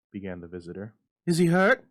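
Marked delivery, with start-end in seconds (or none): 1.2-1.8 high, loud, frantic question; a mother desperate for news; shrill panicked demand; wild demand. A narrator's reading. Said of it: "demanded mother wildly".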